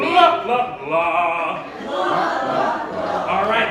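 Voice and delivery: booming, goofy la-la-la; Seinfeld belly voice. Shown as a demonstration. Leader models warm-up for group, loud belly voice. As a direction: belt out each la from the belly. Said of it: "it's a Seinfeld belly voice".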